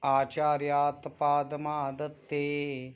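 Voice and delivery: ordinary talk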